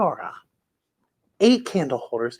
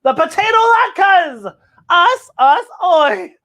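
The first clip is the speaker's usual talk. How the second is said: cheering; 10 semitones higher, 6 dB louder, slower than usual. Acted. Joyful cheer.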